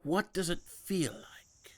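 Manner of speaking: slow, hushed whisper. Acted. Mentor asking Peter what he senses, whispering. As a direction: breathe the question softly.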